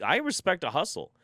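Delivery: a proud little declaration, said up high; emphatic repeat. Acted. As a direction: stress on "respect", high and sure. Repeats the phrase, first high.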